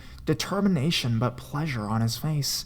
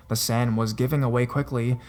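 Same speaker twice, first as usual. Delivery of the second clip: plain reading voice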